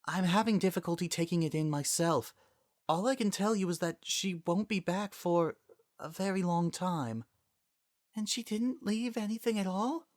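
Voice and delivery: lightly voiced grieving women. Celestia and Dawn voiced.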